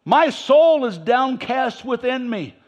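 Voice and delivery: high, slow, loud complaint to God; whining prophet. Acted. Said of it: "He's still whining".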